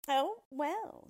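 high, loud show of condescension; theatrical reluctant concession; grudging dwarf. Acted.

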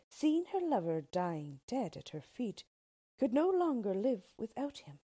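ordinary delivery, nothing marked

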